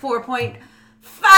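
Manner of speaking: rising to a high, loud shout on five; Nick Cage yell. Pretend. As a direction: build up and explode on the last word. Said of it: "Nicolas Cage".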